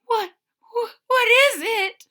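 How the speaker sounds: high, shaky scared squeak; frightened kitten. A narrator's reading. Little Sarah asking her brother, frightened. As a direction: stammer it out in fright. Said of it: "asks Sarah, shaking".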